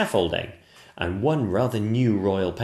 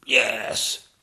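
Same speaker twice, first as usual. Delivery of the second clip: high-pitched kingly agreement; agreeing King. Acted. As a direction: agree emphatically in a put-on king's voice. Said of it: "agreed the King".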